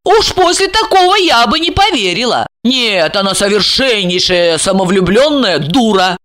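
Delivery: judge her with disdain; scornful woman. Acted. Plays Regina, scornful.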